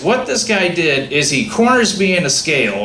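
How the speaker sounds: usual speaking voice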